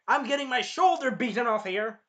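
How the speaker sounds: high, loud complaint; hammed-up pained yell. Hero being mauled by monster dog, yelling. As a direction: yell it as if under attack.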